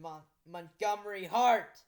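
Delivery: loud, drawn-out exclamation; dramatic reveal. A narrator's reading. Narrator reveals who was speaking, dramatic exclamation. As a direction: announce the name slowly and loudly.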